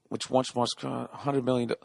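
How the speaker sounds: ordinary delivery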